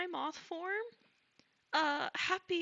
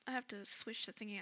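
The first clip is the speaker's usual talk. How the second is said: normal talking voice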